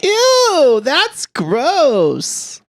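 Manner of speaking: high, loud squeaky disgust; high mock disgust. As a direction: squeal it out grossed out. Reacting to nickname.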